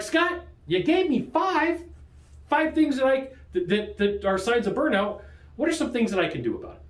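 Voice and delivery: high-pitched imitation of a puzzled viewer; questioning viewer. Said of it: "So you're like".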